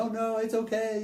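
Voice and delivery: high, loud mock crowd protest. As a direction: play the eager crowd. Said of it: "most of them go".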